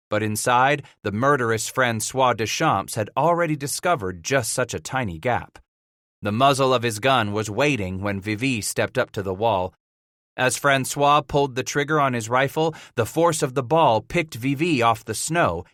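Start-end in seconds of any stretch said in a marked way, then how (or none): none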